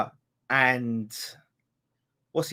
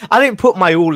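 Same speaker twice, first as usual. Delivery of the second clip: loud; 7 semitones higher, 13 dB louder than usual. Real, not acted. Repeats the line louder, then quieter.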